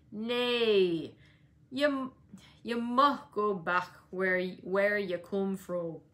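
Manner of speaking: put-on old Joseph's Yorkshire grumble. A narrator's reading. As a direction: growl him away, slow and sour. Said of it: "screamed through his nose".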